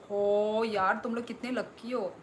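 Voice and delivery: ordinary talk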